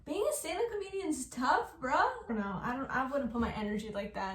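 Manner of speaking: ordinary delivery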